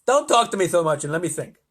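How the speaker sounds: irritable President; impatient. A narrator's reading. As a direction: brush him off impatiently. President silences astronaut.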